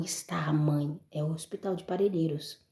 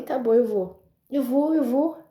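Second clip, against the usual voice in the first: tearful agreement; 8 semitones higher, 9 dB louder than usual. Retold or imitated. Mother agreeing to rest, tearful.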